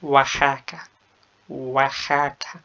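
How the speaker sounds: اواحاكا said waxaka with kh; slow اواحاكا with a kh sound; Oaxaca said in Spanish; Spanish pronunciation. Shown as a demonstration. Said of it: "بالإسبانية".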